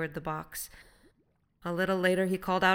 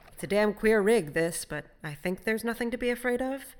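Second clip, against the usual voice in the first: "afraid of" lifted high like a question; unsure statement, rising toward "afraid of"; 5 semitones higher, 5 dB louder than usual. A narrator's reading. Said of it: "There was a question in his tone".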